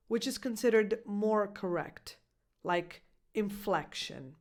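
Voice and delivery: ordinary delivery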